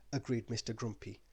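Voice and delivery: ordinary delivery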